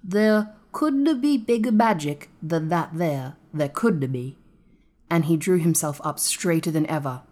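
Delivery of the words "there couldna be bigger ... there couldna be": slow, pondering dialect; measured, wondering pronouncement. A narrator's reading. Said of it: "he said slowly".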